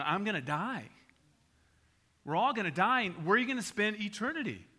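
ordinary talk

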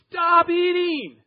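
sudden high, loud yell; mind screaming. Retold or imitated. Preacher voicing his mind's alarm yelling stop eating. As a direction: shout the alarm in your head.

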